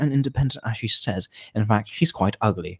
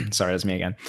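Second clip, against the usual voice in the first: ordinary talk, nothing marked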